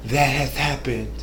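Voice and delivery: mock Lady Stark. Vlogger mocking Lady Stark's self-pity, soft low lament. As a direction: mope it out like a martyr. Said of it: "She said".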